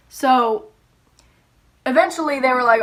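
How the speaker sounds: usual speaking voice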